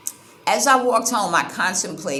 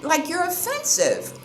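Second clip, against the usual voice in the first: blunt retort; 10 semitones higher than usual. Retold or imitated. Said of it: "And I'm like".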